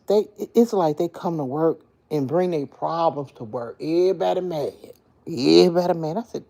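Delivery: ordinary talk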